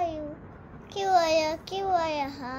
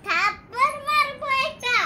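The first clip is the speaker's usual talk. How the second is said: higher and louder, shouting the threat; loud playful threat; 8 semitones higher, 8 dB louder, faster than usual. Pretend. Child shouting a pretend threat at mother, loud. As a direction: yell it at mum in play.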